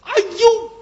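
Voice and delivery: high, loud exclamation of relief; old man's cry. Acted. Old man sees his lost bundle, high relieved cry. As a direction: cry out as the lost money appears.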